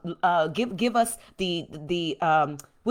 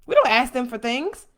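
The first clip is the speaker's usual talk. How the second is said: dismissive friends; 3 semitones higher, 5 dB louder than usual. Retold or imitated. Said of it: "they're like".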